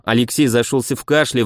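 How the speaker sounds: usual speaking voice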